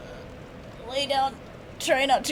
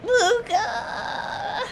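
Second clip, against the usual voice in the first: high, crying call, rising at the end; crying out her name; 13 semitones higher than usual. Real, not acted. Streamer calling out to her fallen ally Luca, crying call. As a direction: call out to a lost friend, half sobbing. NPC Lucatiel dies on stream.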